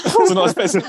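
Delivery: words broken by real laughter; laughing. Real, not acted. Startled teller laughing as he explains to his cohost. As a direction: laugh through it after the scare.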